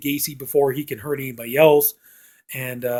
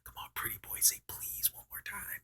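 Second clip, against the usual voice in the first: very soft, breath-only taunt; mocking whisper; 24 dB quieter than usual. Killer mocking his terrified captive's pleas, taunting whisper. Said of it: "He's just mocking".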